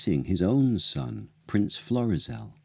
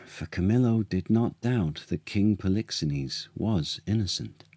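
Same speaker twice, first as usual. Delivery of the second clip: usual speaking voice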